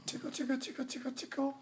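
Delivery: high squeaky tickle chant; sing-song high tickles; giggly tickle attack. Pretend. Tickling on request.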